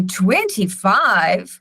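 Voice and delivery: slow, drawn-out twenty-five with the pitch swooping up, shocked and judging; judgmental surprise. Shown as a demonstration. Clinician reacting to the patient's number, judgmental rising tone. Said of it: "So your voice goes up".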